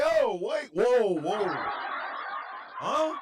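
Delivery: high, loud startled whoa with a laugh; laughing mock double take; mock shock. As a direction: act caught off guard. Reacting to the creepy DM.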